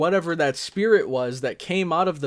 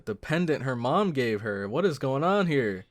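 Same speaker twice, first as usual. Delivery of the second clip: ordinary talk, nothing marked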